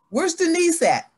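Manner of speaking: a mother's sharp call. Retold or imitated. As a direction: shout for the child from another room. Mother calling for her child.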